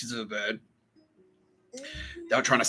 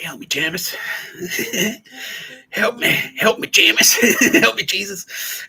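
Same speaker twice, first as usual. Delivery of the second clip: laughing mock plea; 10 semitones higher, 6 dB louder, slower than usual. Pleading quote, breaking into laughs.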